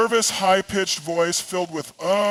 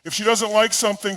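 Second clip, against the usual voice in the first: ordinary talk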